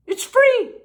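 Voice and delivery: high, put-on patient voice; squeaky patient. Patient telling the doctor coffee is free, high put-on voice. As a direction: pop up high like a delighted patient.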